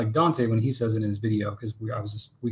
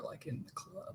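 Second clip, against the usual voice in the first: whisper; 19 dB quieter, slower than usual. Acted. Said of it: "confiding aside to viewers".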